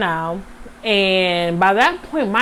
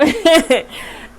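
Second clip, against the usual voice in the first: embarrassed laugh; 8 semitones higher than usual. Real, not acted. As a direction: burst of laughter at herself. Laughs at herself.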